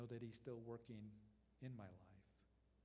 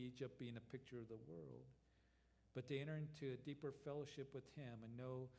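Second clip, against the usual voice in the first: usual speaking voice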